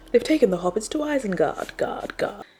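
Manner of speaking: mock-epic recital; dramatic quote. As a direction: boom the famous line. Reading famous line dramatically.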